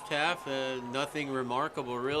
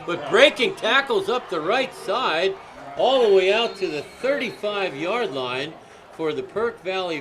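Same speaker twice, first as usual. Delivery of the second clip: excited; 3 semitones higher, 6 dB louder than usual. Real, not acted. Big kick return play-by-play.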